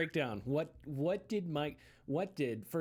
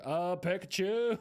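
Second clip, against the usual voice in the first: Egoraptor-style Pikachu; 5 semitones higher, 5 dB louder, slower than usual. Shown as a demonstration. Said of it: "like Egoraptor".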